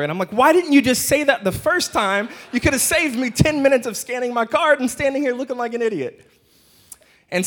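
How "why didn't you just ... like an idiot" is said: much higher, louder indignant outburst; fed-up complaint, voice shooting up; exasperated retort. Retold or imitated. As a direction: let the frustration burst out at the cashier.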